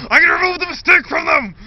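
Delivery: high, loud mad-scientist yell; villainous shout. Retold or imitated. Dad in the story proclaiming his plan in a loud mad yell. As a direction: announce it like a gleeful maniac.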